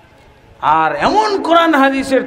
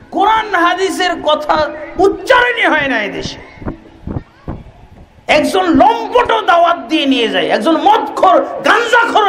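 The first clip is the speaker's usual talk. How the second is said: higher, louder preaching with scornful laughs; mocking fiery preaching; 8 semitones higher, 3 dB louder than usual. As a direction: ridicule the rival convoys to the crowd.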